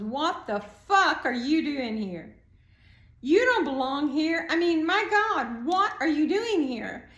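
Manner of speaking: sharp, loud disbelief rising to a shout; shocked outburst. Retold or imitated. Teacher blurts at the new kid, a loud shocked outburst.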